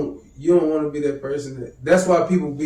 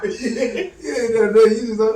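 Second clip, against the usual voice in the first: high, loud, laughing words; talking through laughter; 9 semitones higher, 6 dB louder, slower than usual. Real, not acted. Friend recalling a cartoon scene, laughing. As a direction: struggle to talk while cracking up.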